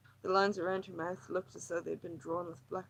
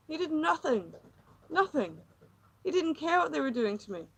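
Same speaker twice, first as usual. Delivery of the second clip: louder, higher, bitter anger; bitter outburst; 8 semitones higher, 6 dB louder than usual. Acted. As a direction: spit out the betrayal.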